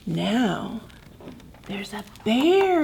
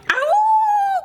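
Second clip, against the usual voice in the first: much higher, louder pained ow; loud cry; 4 dB louder than usual. Acted. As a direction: yell ow.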